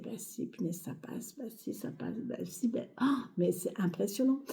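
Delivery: muttering doubts to oneself, very soft; half-voiced retold self-reassurance, under the breath; doute marmonné. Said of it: "il y a toujours ce doute-là". (se rassurer à mi-voix, dans le doute)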